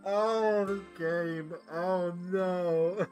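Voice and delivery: high, drawn-out groaning wail; loud dismay. Real, not acted. Player reacting to the game's dark joke, loud dismay. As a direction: cry out in amused dismay.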